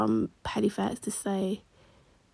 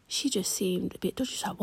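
ordinary talk, nothing marked

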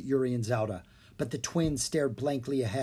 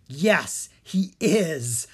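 gleeful; 2 semitones higher, 5 dB louder, much slower than usual. Acted. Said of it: "with glee in his voice".

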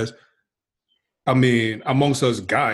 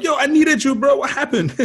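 much higher, louder hammed-up complaint; mock hurt friend; 4 dB louder than usual. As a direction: play the wounded buddy for laughs.

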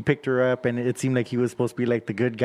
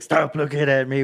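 imitating a demon girl's voice. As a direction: voice it like the possessed girl. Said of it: "with her demon voice".

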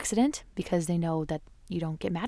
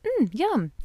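put-on quoting toddler son; 8 semitones higher than usual. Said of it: "he was like".

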